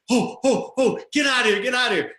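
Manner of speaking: high-pitched panicky imitation; freaking out. Lead singer urging the driver to flee the pier, freaking out. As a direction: mimic a passenger in a panic. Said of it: "my lead singer next to me is freaking out".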